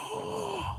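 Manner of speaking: high, soft drawn-in gasp; sharp theatrical intake of breath; mock gasp. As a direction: gasp in feigned shock. Feigned shock at coincidence.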